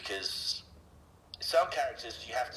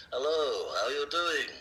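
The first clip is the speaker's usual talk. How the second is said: higher-pitched Alec Guinness impression; Obi-Wan Kenobi; 6 semitones higher, 3 dB louder, slower than usual. Shown as a demonstration. Voice actor demonstrating for the interviewer, Obi-Wan greeting a little friend. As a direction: greet kindly as the old Jedi master. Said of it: "I can talk like Obi-Wan Kenobi or Alec Guinness".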